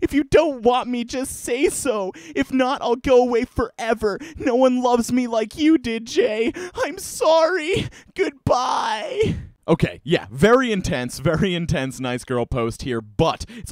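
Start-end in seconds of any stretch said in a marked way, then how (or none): 0.0-9.3 very high, whiny, sobbing begging; desperate crying plea. Acted. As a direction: wail for him to take her back. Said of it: "apologetic and sad".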